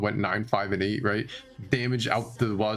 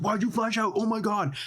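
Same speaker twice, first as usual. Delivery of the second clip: higher-pitched mock complaint; exasperated imitation of whining teammates; mock accusing teammates; 9 semitones higher than usual. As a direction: imitate teammates blaming you, pitched well up. Acting out teammates blaming him.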